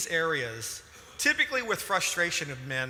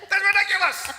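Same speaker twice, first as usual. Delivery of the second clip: much higher and louder laughing protest; amused outburst; 8 dB louder than usual. Real, not acted. Husband protesting his wife's teasing, high and loud. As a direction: burst out in delighted disbelief.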